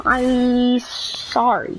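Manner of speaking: very slow, stretched retold sorry; drawn-out apology. Student apologising to offended classmate, drawn out slowly. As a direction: stretch both words right out.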